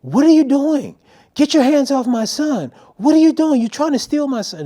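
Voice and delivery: high, loud frantic mom yelling; screaming mother. Retold or imitated. Mom to a stranger holding her son, screaming. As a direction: scream accusations in a shrill-pitched panic. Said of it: "she's screaming".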